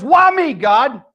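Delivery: high, loud cry up to God; exasperated Job. Retold or imitated. Job asks God about his bad fortune, exasperated. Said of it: "Job throws up his hands and says".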